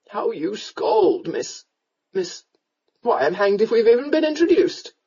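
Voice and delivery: drawn-out tipsy protest rising at the end; drunken rogue, befuddled amazement. A narrator's reading. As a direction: marvel tipsily, stretching the first words. Drunken rescuer's voice.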